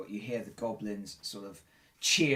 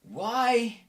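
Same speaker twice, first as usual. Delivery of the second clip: higher-pitched, puzzled questioning; confused captive, pitched up, halting between phrases; bewildered rescued man; 8 semitones higher, 4 dB louder than usual. Acted. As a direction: draw out the first word, then ask in disbelief. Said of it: "He's go".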